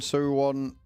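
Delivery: ordinary talk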